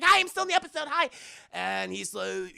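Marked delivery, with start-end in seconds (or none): 0.0-1.1 high, loud comic pipe-up; mock eager little hello; chirpy cameo. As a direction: call out in a raised, squeaky-high greeting. Forgotten character pipes up.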